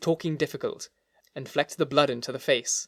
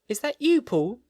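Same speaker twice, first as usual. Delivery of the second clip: fearful voice within; 10 semitones higher, 3 dB louder than usual. A narrator's reading. Frightened voice from behind door.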